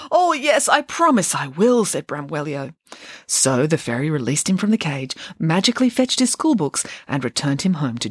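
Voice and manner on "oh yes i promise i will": put-on high, keen little-boy voice; eager promise. Puppet promises the fairy to be good, eager promise.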